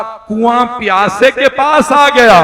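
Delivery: a touch of weeping joy; tearful. Acted. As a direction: let the relief break through in tears. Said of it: "जनाबे हुर रोने लगे".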